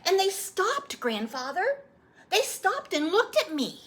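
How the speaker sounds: put-on high, eager child voice; a little boy pleading for the story; young boy. Boy asking his grandfather to retell his birth, eager child voice.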